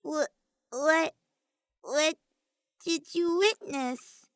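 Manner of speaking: put-on high stammering girl's voice, slowing down; nervous. A narrator's reading. Himiko nervously asks what K1-B0 saw, high stammer. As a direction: stumble on the words, uneasy and high. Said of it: "with an undeniable note of nervousness".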